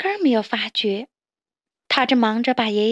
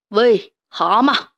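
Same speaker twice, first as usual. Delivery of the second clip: slow, louder threatening call; menacing call; 5 dB louder, slower than usual. Acted. Snapping turtle threatens the toads, slow menacing call. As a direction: drag it out like a hungry turtle. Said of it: "鳄龟恶狠狠地说".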